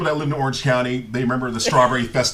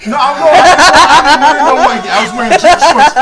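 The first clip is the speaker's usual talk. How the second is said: loud, high, laughing protest; laughing loudly; 11 semitones higher, 14 dB louder than usual. Real, not acted. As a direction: push the denial out through laughter. Answering mid-laughter.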